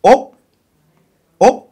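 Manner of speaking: ordinary delivery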